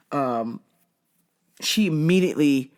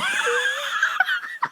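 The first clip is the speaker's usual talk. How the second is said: big high burst of laughter; 3 dB quieter than usual. Real, not acted. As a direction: cackle at your own punchline. Laughing at own story.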